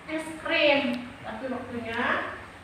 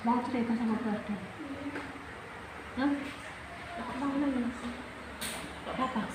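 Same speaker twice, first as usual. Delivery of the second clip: gentle coaxing; 11 dB quieter, faster than usual. Real, not acted. Teacher coaxing a sad pupil.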